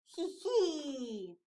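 light acted hee-hee; storybook penguin giggle; little giggle. A narrator's reading. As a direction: titter playfully. Flip giggles after his threat.

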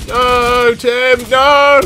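loud, high cry of alarm; desperate shout. Real, not acted. Player yelling at teammate Tim, desperate shout. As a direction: yell as if you could stop it.

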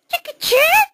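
very high squeaky mouse question. Acted. Mouse querying which movie is on, high mouse voice. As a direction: squeak JACKIE CHAN as a tiny mouse asking. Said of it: "no more mouse voice".

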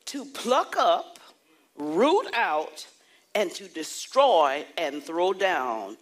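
slow, loud declaiming of each verb; scripture proclamation. Acted. Preacher to congregation, reading Jeremiah in a slow loud proclamation. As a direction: thunder the verse out like a prophet.

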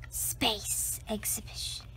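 whispered aside, unvoiced; hushed. Narrator whispers the sign in awe. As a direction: breathe the words like a secret.

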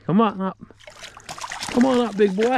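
high, excited urging; excited coaxing. Real, not acted. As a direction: coax the catch up in a high, eager voice. Coaxing hooked fish up.

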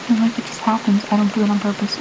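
ordinary delivery

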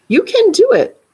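high, loud cheering on; encouraging teacher. A narrator's reading. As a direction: cheer her on loudly and high.

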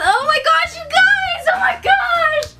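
high, teary squeal of delight; squealing over a gift she loves; real happy shriek; overjoyed squeal. Real, not acted. Gasping at a gift.

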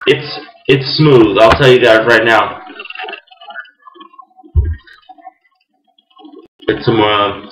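talking around pipe stem; pipe in mouth. Real, not acted. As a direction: teeth clenched on pipe. Seen 1: Pipe stem clenched in teeth throughout; reference frames hold pipe away.

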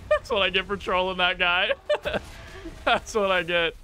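talking through high laughter; real laughing. Real, not acted. Gamer laughing at what he gets for trolling that guy. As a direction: laugh at yourself between the words. Laughing at own in-game death.

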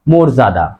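মর্যাদা said "morjada"; rounded o starting মর্যাদা; correct pronunciation. Shown as a demonstration. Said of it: "সঠিক উচ্চারণ কি হবে".

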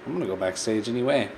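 ordinary talk

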